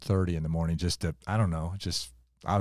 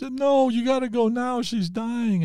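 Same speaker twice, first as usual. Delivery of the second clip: very high, insistent mock wail; imitated tearful plea; 4 dB louder than usual. Church lady refusing to let the tired pastor wait, imitated tearful urgency. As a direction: act out her high, loud, tearful insistence. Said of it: "comes to me in tears".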